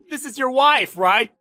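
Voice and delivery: high, loud taunting with laughter; cruel, laughing bragging; gloating mockery. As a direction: crow over the victim, cackling between jabs. Boss gloats about stealing the wife.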